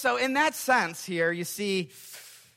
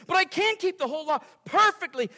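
in anguish; 9 semitones higher, faster than usual. Pretend. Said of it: "I reply again in anguish".

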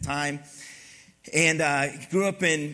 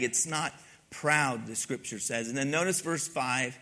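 normal talking voice

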